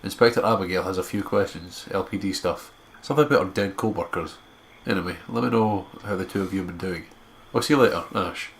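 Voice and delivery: steady storyteller read; story narration. Acted. Narrating the walk back to school, even storyteller tone. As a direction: carry the listener through the scene. Reads visual novel narration.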